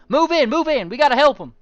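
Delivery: loud, high Canadian orders; Canadian accent. Acted. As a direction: urge them forward in a Canadian accent. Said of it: "He says that in a Canadian accent".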